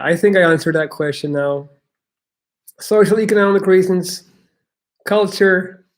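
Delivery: normal talking voice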